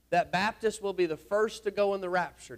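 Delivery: ordinary talk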